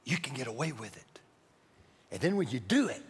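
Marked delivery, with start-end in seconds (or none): 0.0-1.2 hushed, low tempting murmur; sly whisper. Acted. Devil tempting a listener in the right ear, hushed whisper. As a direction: drop right down, as if into someone's ear. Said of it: "He will whisper in your right ear".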